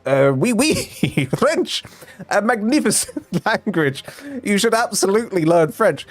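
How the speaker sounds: hammed-up French teacher accent, laughing through it; French teacher gushing to parents in a bad French accent; mock French accent. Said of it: "This is not a French accent".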